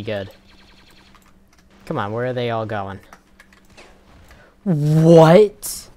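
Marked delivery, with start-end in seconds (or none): 4.6-5.9 loud rising shocked what. Real, not acted. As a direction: yell it in disbelief. Sudden death.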